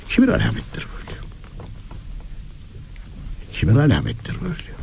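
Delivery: ordinary talk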